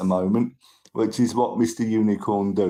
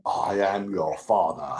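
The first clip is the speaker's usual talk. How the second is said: lower, softer mock Vader line; Darth Vader; 7 semitones lower, 4 dB quieter than usual. Telling a street Vader his famous line, lower and softer. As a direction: drop low and soft for a playful Vader. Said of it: "And I just said to him".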